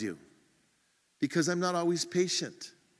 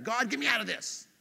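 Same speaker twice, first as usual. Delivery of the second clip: mock urgent, higher-pitched plea; impatient plea; 5 semitones higher than usual. Impatient believer praying to God for a quick fix, mock pleading. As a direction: demand an instant rescue, hammed up a little.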